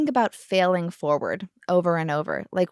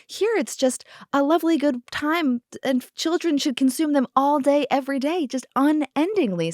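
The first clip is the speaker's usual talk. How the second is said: sarcasm pitched up high, mock delight; sarcastic lovely; 7 semitones higher than usual. Mom lecturing a child guest, higher sarcastic mock delight. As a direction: sing it up with sweet mock cheer. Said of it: "I was like".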